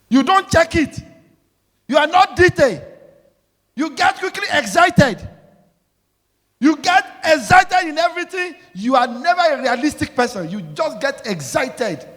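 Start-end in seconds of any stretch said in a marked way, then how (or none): 0.1-10.5 much higher, louder rebuke; scolding. Real, not acted. Preacher rebuking the congregation in a high, loud scold. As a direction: scold them hard, voice up high.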